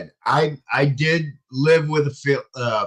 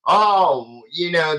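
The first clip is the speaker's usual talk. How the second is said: ordinary talk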